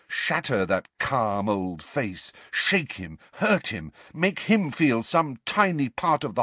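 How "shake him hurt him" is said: ordinary delivery, nothing marked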